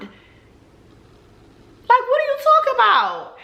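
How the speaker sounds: breathy high squeal of disbelief on "like", then "what are you talking about"; mock outrage, way up high; high, strained, exasperated disbelief; incredulous. Exasperated retort in retold date.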